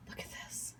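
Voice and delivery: soft breathy whisper of awe. Real, not acted. Cook showing the bowl to viewers, awed whisper. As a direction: whisper it like a secret treasure.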